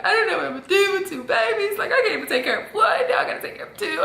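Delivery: high, fast, laughing panic; panicked rant. Retold or imitated. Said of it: "And I'm like".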